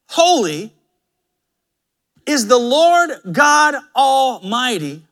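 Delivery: solemn, loud calling in praise; seraphim calling out. Acted. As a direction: call each "holy" out, then slowly declare. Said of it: "these seraphim are calling to one another".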